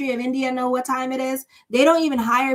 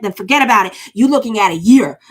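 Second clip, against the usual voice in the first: louder, punching the warning home; emphatic loud warning; 9 dB louder than usual. Real, not acted. Tarot reader warning viewers, loud and emphatic. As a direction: lay down the law about a bad card. Warning about the Page of Cups.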